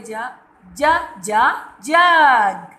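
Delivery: ज, ज, ज, then जग very slowly and loud; sound out the letter. Shown as a demonstration. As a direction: tap out ज and stretch जग. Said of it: "किसकी आवाज़ आ रही है?". (ज की आवाज़ पर ज़ोर, जग धीरे से)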